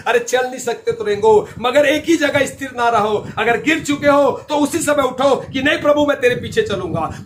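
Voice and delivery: rally them, climbing with each clause; rousing preaching. Real, not acted. Preacher urging the fallen to rise and follow, building fervour. Builds an exhortation to keep going.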